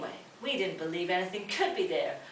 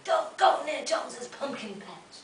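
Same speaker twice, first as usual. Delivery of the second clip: warning townsfolk; 8 semitones higher, 7 dB louder than usual. Acted. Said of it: "they'll say to you".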